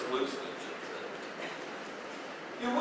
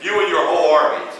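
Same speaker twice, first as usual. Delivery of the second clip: louder, higher brisk command; king giving orders; 4 semitones higher, 6 dB louder than usual. Retold or imitated. King sending Naaman and his army to Elisha, loud. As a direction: send the army off like an eager king. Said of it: "I can imagine the king of Israel going".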